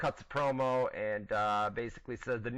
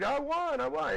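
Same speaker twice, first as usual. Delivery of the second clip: much higher, hammed-up gleeful shout; mock victory celebration; 14 semitones higher, 4 dB louder than usual. Mocking Daniel Bryan's over-the-top win celebration, much higher and gleeful. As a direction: lampoon a wrestler's giddy cheering. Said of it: "Ran around the ring, dude".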